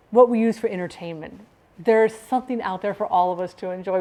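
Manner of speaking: ordinary delivery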